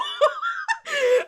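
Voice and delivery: a big burst of laughter, high and loud. Real, not acted. Laughing hard at dad's exit, loud high laugh. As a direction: crack up loudly.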